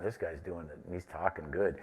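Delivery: low, quiet thought to himself; retold private aside. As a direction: drop it low, as if privately won over. Retold private reaction during phone call.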